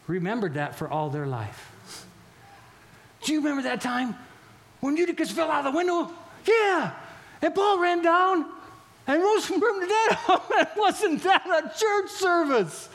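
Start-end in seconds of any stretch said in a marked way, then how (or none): 3.2-12.9 high, loud and laughing; excited, laughing. Acted. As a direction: burst out in joyful disbelief.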